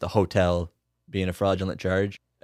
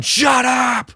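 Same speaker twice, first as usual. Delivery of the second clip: loud, high furious shout; 5 dB louder than usual. Acted. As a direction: yell it at the top of your lungs. Suspect shoots at ceiling.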